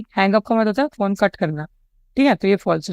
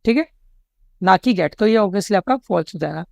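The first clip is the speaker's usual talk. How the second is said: normal talking voice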